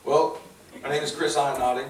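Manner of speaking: ordinary talk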